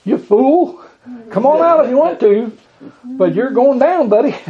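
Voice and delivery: playful mock warning; mock scolding. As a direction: taunt him jokingly, on the edge of laughing. Mock answer Jesus didn't give.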